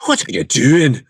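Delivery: mock complaint. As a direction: scoff at someone faking your accent. Southerner mocking bad accent imitation.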